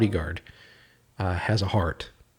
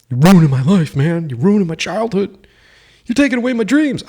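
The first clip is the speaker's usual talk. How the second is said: whiny fan; 7 semitones higher, 5 dB louder than usual. Retold or imitated. Mocking angry fans' complaints.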